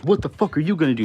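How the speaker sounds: loud, fast, high mock confrontation; aggressive challenge. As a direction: imitate an angry driver squaring up. Retold aggressive challenge from the driver.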